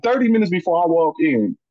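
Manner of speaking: ordinary talk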